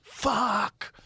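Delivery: mock yell. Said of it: "He's just fucking screaming and yells".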